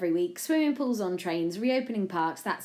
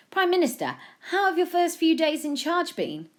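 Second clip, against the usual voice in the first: higher, projected press question; raised-voice reporter's question; keen reporter; 12 semitones higher, 7 dB louder than usual. A narrator's reading. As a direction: put it eagerly to the Prime Minister.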